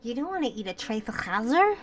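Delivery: loud, slow chazer with a hard throaty ch; emphatic chazer. Shown as a demonstration. Insisting on her community's word, loud and slow. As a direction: drag out and boom chazer. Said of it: "We say chazer".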